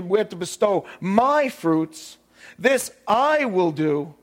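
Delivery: slow reading stressing I and my; emphatic reading. Shown as a demonstration. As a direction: lean on every I and my, slowly. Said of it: "I'm gonna read it with emphasis in a different way".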